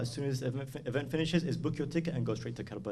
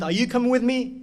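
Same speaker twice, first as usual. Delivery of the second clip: high, raised ringing call; louder and higher, summoning someone; acted urgent call; 10 semitones higher, 8 dB louder than usual. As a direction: call out the question to each follower. Imam's call to each man, acted.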